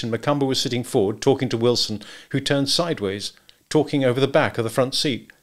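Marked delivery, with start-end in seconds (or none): none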